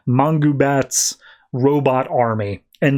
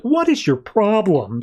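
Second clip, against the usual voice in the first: higher, slow mock exasperation; exasperated Lois; 8 semitones higher than usual. Lois snaps at Catwoman, slow and higher. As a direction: drag out the indignant question. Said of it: "And Lois is like".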